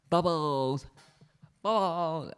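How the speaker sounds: hammed-up jolly cheer; really jolly. Shown as a demonstration. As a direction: call it out merrily. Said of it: "say it really jolly".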